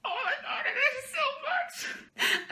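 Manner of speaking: high, teary voice, laughing and crying; overwhelmed, tearful joy. Real, not acted. Reading the friend's birthday card, crying with joy. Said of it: "there have always already been tears".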